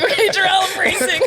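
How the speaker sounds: high, laughing strained answer; mock strained reply. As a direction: play the struggling hero. Mock reply while in danger.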